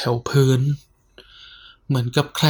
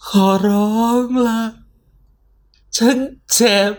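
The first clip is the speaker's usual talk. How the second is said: high, tearful begging voice; crying plea; 10 semitones higher than usual. Acted. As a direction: sob out the plea in terror. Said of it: "ฉันร้องไห้ออกมาจนได้". (ร้องไห้อ้อนวอนเสียงสูง)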